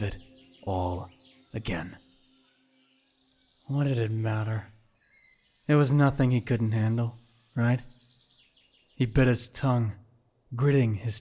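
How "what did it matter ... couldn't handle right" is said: normal talking voice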